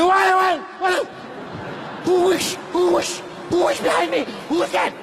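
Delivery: high, frantic panic at a whoosh; panicked. Acted. Alone in a house, panicking at a whoosh behind him. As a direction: spin round in alarm, voice up high.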